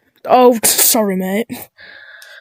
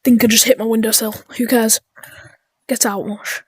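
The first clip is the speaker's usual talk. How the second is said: ordinary delivery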